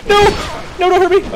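high, loud frightened plea; panicked pleading. Real, not acted. Streamer begging the enemy not to hurt him, high frightened yell. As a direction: cry out to the enemy for mercy.

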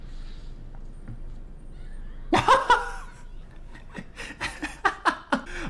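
long, high wheezing laugh; real laughing fit. Real, not acted. Viewer cracking up at the show, long wheezy laughter. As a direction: crack up helplessly, squeaking at the peaks.

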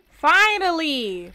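long, slow, drawn-out relieved cry; drawn-out finally. Real, not acted. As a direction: stretch the word out in relief. Finally getting into the hot tub.

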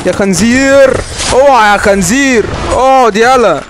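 high, loud insulting shout at the enemy; playful yell. Real, not acted. Yells at enemy player.

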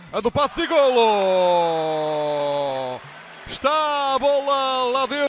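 slow, loud, high goal call; explosive goal commentary. Real, not acted. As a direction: draw out the goal, thrilled.

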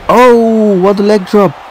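high, impressed wrestling call; commentator's thrilled "oh"; excited exclamation. Real, not acted. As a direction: burst out at a big hit. Reacting to a big hit.

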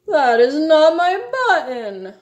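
theatrical high lament; wail. A narrator's reading. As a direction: wail it out in exasperated distress. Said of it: "wailed Toad".

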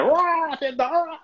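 sudden high alarmed cry; mock fright at a truck; startled shout. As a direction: yelp as the trailer appears. Trailer suddenly behind the car.

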